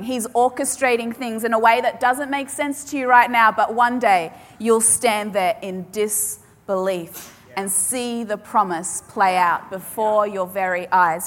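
ordinary talk

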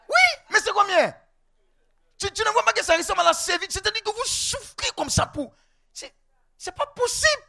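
high-pitched, climbing disbelief; exasperated, voice rising very high; incredulous protest. Acted. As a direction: squeal it as if it can't be possible. Incredulous at a meagre family salary.

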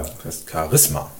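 low, slow „Charisma“ as Cha-RIS-ma; second-syllable stress. Shown as a demonstration. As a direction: stress RIS in „Charisma“, taking your time. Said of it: "da wird die zweite Silbe betont". („Charisma“ langsam und tief, auf RIS betont)

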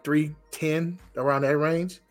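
usual speaking voice

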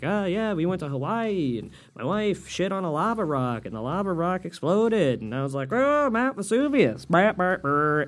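exaggerated joke-telling voice, building to the punchline; mock comic's monologue. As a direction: parody a comic forcing a canned bit into conversation. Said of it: "monologue doing his goddamn joke".

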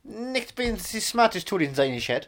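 lyrics recited as fake German; hammed-up Germanised English; mock German accent.

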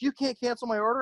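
high-pitched, half-hearted mock scream. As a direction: imitate an outraged customer screeching. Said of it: "she's, like, screaming".

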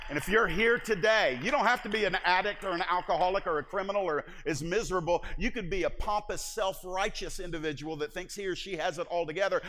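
loud, high, driving preacher's call; full-force preaching. Acted. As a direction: preach it to the back row.

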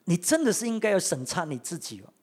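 ordinary delivery

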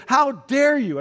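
high, loud, indignant "how dare you"; retold outraged cry. Retelling the offended hospital woman's outraged cry. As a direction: voice her fury at the chaplain. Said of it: "one woman was just seething with outrage".

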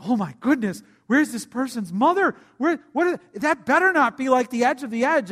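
alarmed wife. Retold or imitated. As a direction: fret aloud about the child. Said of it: "So Lauren's like".

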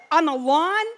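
high, loud incredulous questions; disbelieving, pushing for details. Retold or imitated. Asking wife where the fingers were, incredulous.

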